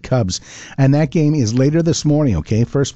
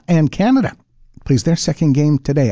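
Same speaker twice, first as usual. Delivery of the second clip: ordinary talk, nothing marked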